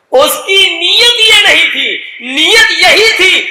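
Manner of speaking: slow, high emphasis on نیت; stress on intention. Real, not acted. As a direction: drive home نیت slowly and high. (نیت پر زور)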